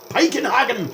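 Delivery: high loud mock-German yell of Piekenhagen; yelled with funny accent. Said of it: "it's just fun to yell his name".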